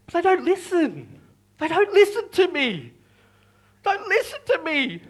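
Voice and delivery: high-pitched, helpless complaint; plaintive exasperation. Acted. Preacher admits his storms ignore him, plaintive exasperation. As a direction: lament it after the shouting has failed.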